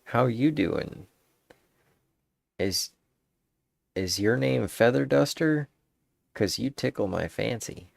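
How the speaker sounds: mock suave flirt line; smooth pickup line. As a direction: roll out the line like a cheesy flirt. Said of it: "the other one says".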